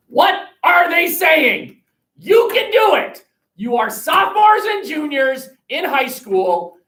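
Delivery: high, loud, slow urging; encouraging shout. Real, not acted. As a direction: push the class to try. Teacher urging students loudly.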